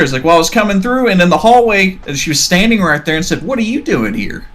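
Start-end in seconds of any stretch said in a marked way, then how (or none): 0.2-3.4 put-on higher, urgent child's telling; scared kid. Boy describing the ghost in the hallway, frightened. 3.4-4.5 put-on higher, strange old woman's question; ghost lady. Ghost lady confronting the boy, eerie. Ghost lady's words, retold by kid.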